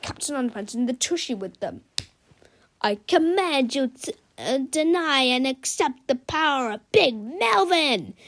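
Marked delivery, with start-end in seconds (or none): 2.8-8.1 higher, stumbling villain's shout; shouted command. A narrator's reading. Melvin ordering Captain Underpants, mock shout. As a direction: bark the order and fumble the middle. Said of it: "he shouted".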